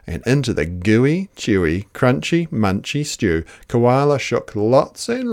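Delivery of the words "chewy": ordinary delivery, nothing marked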